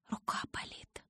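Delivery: very soft, whispered complaint; weak whisper. A narrator's reading. Injured girl saying her hand hurts, faint whisper. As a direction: barely get the words out.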